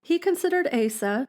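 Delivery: ordinary delivery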